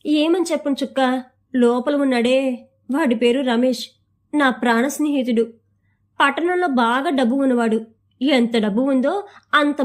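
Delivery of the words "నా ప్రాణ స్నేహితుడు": usual speaking voice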